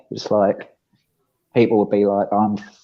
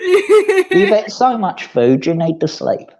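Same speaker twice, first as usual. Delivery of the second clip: higher, slow, laughing coo at a full cat; pet talk to cat; 12 semitones higher, 3 dB louder, slower than usual. Pretend. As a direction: tease the cat gently while laughing. Soothing the sleepy cat.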